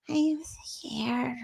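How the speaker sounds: tiny breath of mock fear; mock-timid, barely voiced whisper; imitating scared whisper. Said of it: "does everything terrify her".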